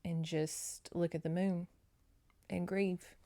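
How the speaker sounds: a softly sincere talk to Jenny; tender empathy. Retold or imitated. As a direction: speak gently like comforting a friend. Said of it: "I'm like".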